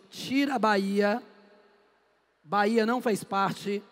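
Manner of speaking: usual speaking voice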